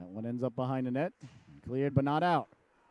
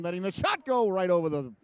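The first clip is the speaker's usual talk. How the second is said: excited call; 4 semitones higher, 4 dB louder than usual. Real, not acted. Shot over the net.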